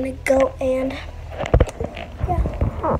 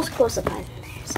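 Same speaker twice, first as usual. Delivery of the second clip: whispering; 5 semitones lower, 10 dB quieter than usual. Real, not acted. Whispered aside.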